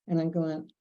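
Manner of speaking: ordinary talk, nothing marked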